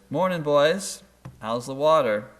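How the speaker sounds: lightly voiced hearty older fish; older fish greeting. Older fish nods at two young fish, hearty greeting. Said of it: "they happen to meet an older fish".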